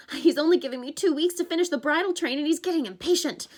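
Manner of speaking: fretting about the deadline; worried satyr. Acted. Grover continues, rising worry.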